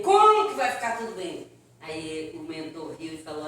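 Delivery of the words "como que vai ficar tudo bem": louder, exasperated protest; indignant shout. Retold or imitated. Dead man snaps back at mentor, loud disbelief. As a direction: throw the question back in disbelief.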